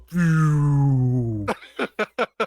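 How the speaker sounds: long sliding vocal whoosh; drawn-out descending noise, then laughter; whooshing sound effect. Retold or imitated. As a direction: imitate the world winding into slow motion. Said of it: "everything just went".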